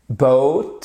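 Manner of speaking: "Boat" with a gliding oh-oo diphthong; diphthong model. Shown as a demonstration. Teacher models the diphthong for learners. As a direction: stretch the vowel of "Boat" into a glide. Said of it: "notre diphtongue boat".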